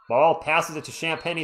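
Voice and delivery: ordinary talk, nothing marked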